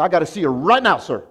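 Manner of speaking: loud, demanding summons; mock the angry owner's call; upset owner. Building owner summoning the pastor, loud and upset. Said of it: "the owner called me upset".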